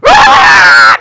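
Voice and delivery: high, loud comic scream; hammed-up scream of terror; childlike scream. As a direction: shriek like a little child flung through the air. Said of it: "I'm screaming like a little child".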